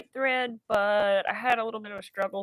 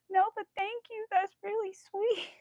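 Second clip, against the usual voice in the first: high humble reply; 12 semitones higher than usual. Retold or imitated. What she wanted to tell the bartender, high and bashful. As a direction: squeak out a shy thank you. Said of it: "I wanted to be like".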